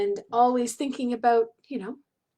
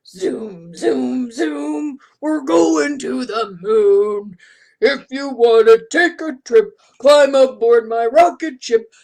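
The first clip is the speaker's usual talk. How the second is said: big booming deep voice, louder; 6 dB louder, slower than usual. Pretend. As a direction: sing it like a big deep giant. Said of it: "we gotta do a deep voice".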